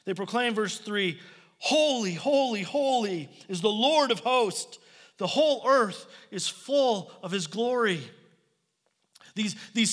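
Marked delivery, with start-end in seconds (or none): 1.6-8.0 high, ringing proclamation of worship; raised, reverent cry with measured phrasing; proclaiming shout. Retold or imitated. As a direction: call it out like angels across a throne room. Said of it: "they shout back and forth".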